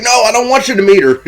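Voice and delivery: high, loud, rushed protest; mock frantic objection. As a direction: blurt it out fast to stop her, imitating him. Bill frantically stops her.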